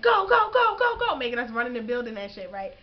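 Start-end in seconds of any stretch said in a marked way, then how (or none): 0.0-1.2 high, much louder shouted commands; drill yelling. Retold or imitated. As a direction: bark them running into the building. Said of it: "They like".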